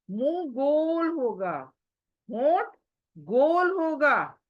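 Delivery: slow, stressed गोल with lips rounded; teacher stressing the key word; rounded-lip demo. Shown as a demonstration. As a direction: stretch out गोल to show the round mouth. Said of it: "showing rounded mouth".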